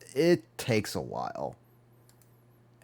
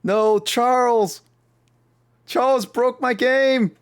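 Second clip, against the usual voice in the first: high, loud, slow cry of dismay; frustrated shout; 7 dB louder, slower than usual. Real, not acted. Yelling at Charles for breaking the game, high and loud. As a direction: wail that the game broke. Game freezes, streamer cries out.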